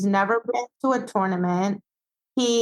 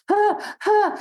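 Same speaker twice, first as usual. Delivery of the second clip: nervous panting; 11 semitones higher than usual. Retold or imitated. Nervous dog panting imitated.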